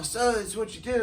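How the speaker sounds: high-pitched imitation of an Australian; Australian accent. Australian guy asking about his work, Australian accent. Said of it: "I meet this Australian guy".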